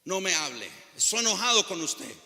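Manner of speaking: loud, acted sulky protest; angry child. Preacher playing a son angry with his father, mock angry. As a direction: play an angry child telling off a parent. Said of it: "Está enojado".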